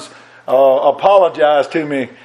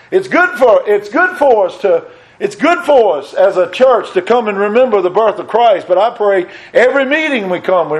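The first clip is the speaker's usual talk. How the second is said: raised, fervent pulpit preaching; climbing sermon fervor, higher and driving; 5 semitones higher than usual. Acted. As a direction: lift it into full preaching. Preacher rises to full force.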